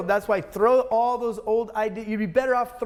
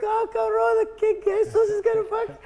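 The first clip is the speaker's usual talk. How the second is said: much higher, alarmed Spanish-speaking worker voice; imitating panicked workers. Employees fleeing his outburst, in a high alarmed mock voice. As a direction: squeal it like scared employees running off.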